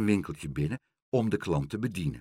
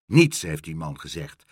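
emphatic, lower and louder exclamation; calling out; 5 semitones lower, 5 dB louder than usual. A narrator's reading. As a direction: exclaim it gruffly, first word loud. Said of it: "riep Karel".

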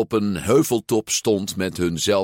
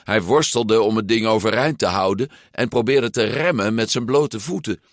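usual speaking voice

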